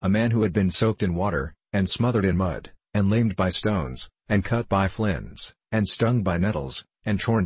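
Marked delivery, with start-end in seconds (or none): none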